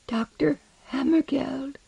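high, soft, slow plaintive voice; thin plaintive cry of a patient. A narrator's reading. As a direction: call feebly and pleadingly, high and drawn out. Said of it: "in a plaintive voice".

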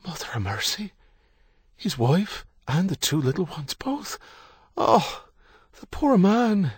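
high-pitched Irishwoman, climbing in dismay; woman's voice, pitying. A narrator's reading. Nursing sister hearing of wife and children lost, pitying. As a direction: cry out in shocked pity, voice rising high. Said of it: "Then the woman's voice, clearly".

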